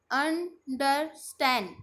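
Understand in three slow, separated beats; syllable by syllable. Shown as a demonstration. Teacher showing the chin-drop test, understand split in three. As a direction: break understand up with a pause after each syllable. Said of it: "चिन के नीचे हाथ लगाओ और बोलो".

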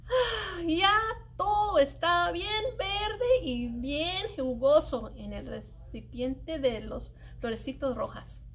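usual speaking voice

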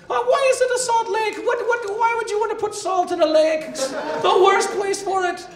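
hammed-up Irish observational comic; Jerry McSeinfeld, Irish Seinfeld. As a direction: stretch out each puzzled question in mock Irish.

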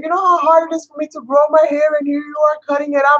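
high-pitched mock distressed protest; exaggerated high lament; imitated crying complaint. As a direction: imitate an upset hairdresser pleading. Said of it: "she was literally crying".

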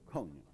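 "Coño" said low and soft; quiet model word. Shown as a demonstration. Teacher repeating "Coño" low for learners to observe. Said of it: "Observe that".

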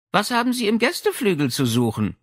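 put-on high, light girlish voice; the old lady challenges the intruders, girlish; bright, almost girlish voice. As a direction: ask sharply in a young girl's voice. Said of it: "die helle, fast mädchenhafte Stimme".

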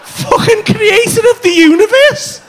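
high, loud, slow indignant echo; incredulous outrage. Retold or imitated. Comedian throwing back his friend's cosmic claim, incredulous outrage. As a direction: draw it out in scornful disbelief.

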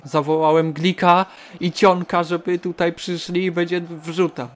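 ordinary talk, nothing marked

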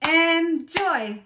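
"Enjoy" split as en, joy with a gap; chopped into syllables. Shown as a demonstration. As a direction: chop "Enjoy" into two beats. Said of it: "chop it out".

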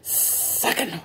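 hissing, whispery snake. Acted. Storyteller as the snake by the river, hissing. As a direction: stretch the first S into a hiss. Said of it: "dice la pinche Snake".

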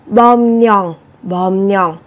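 "bom nhòng" said with the b turned m and the r turned nh, in Korean sound change; Korean liaison. Shown as a demonstration. Teacher shows students the sound change, careful demo. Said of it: "Thì các bạn cần biến đổi thành".

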